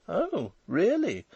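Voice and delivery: high, slow, impressed wife; high-pitched, wondering murmur; interested, impressed murmur. A narrator's reading. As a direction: draw it out with rising interest. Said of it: "Nellie murmured, interested and impressed".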